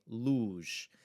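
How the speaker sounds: luge with a long, clear 'oo'; pronunciation demo. Shown as a demonstration. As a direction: model 'luge' on its own, falling tone. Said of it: "It's gonna be U".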